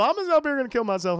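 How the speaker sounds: mock Bill quote. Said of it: "you had Bill".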